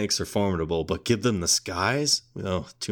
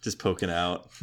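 ordinary talk, nothing marked

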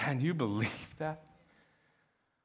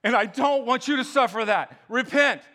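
loud, high urgent plea; earnest loud call to repent; pleading god; 8 semitones higher, 7 dB louder than usual. Retold or imitated. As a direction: beg them to turn back, pressing hard. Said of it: "God's like".

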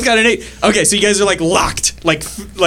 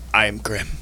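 low monotone creature voice; 6 semitones lower, 8 dB quieter than usual. Acted. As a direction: say it low like a lumbering giant. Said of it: "and says".

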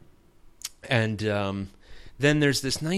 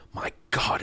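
whispered "My God", barely breathed; awed whisper; 4 dB quieter than usual. Retold or imitated. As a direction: hush it out like a stunned onlooker. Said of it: "you just kinda hear him go".

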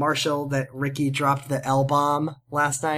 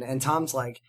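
ordinary talk

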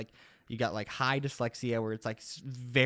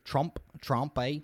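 tentative Australian accent, "Trump" as "Tromp"; unsure stab at an Australian accent; attempted Australian accent; 2 semitones higher, slower than usual. Shown as a demonstration. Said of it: "say Trump with an Australian accent".

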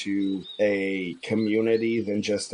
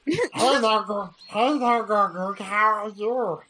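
put-on high nerdy neckbeard kid; fedora neckbeard; 10 semitones higher than usual. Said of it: "a nerdy neckbeard kid voice".

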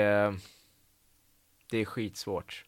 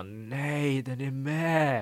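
higher, drawn-out mock dismay; dismayed outcry; 4 semitones higher, much slower than usual. Actor spotting his dreaded scene on the DVD, mock dismay. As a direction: re-enact a long groaned protest.

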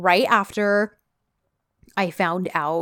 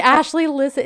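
higher and louder, eager; excited; 7 semitones higher, 5 dB louder than usual. Retold or imitated. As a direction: call her over, thrilled to share. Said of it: "and I was like".

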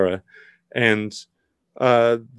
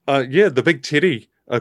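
normal talking voice

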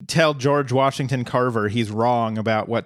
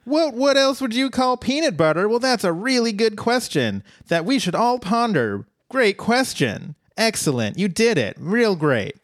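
sarcastic; 8 semitones higher than usual. Pretend. Mocking the card question.

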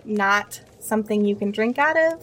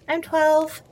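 put-on kid voice; 7 semitones higher than usual. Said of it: "and he goes".

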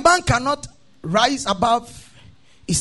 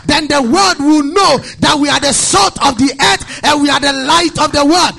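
forceful, mounting sermon delivery; full-force preaching; 2 semitones higher, 6 dB louder than usual. Real, not acted. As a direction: drive each point hard at the congregation.

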